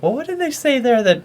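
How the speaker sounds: high, curious viewer voice; puzzled listener. Retold or imitated. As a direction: ask it like a confused fan. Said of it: "they're like".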